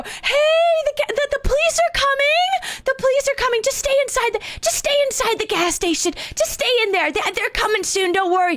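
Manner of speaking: high, over-friendly nervous call. Retold or imitated. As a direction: call out in a squeaked-up, mock-helpful voice. Acting nervously calling out car window.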